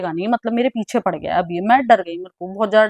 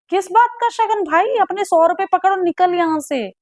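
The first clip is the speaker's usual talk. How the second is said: much higher mock outburst, snapping at him; imagined angry retort; 8 semitones higher than usual. The retort she wishes she had fired at the old man, a mock indignant outburst. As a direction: tell him to take his hundred rupees and leave, pitched way up. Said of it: "But ऐसा मैंने बोला नहीं था".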